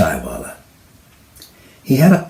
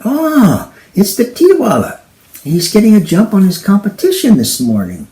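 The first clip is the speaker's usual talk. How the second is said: amused; 10 semitones higher, 4 dB louder than usual. Acted. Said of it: "Daisy started to crack up".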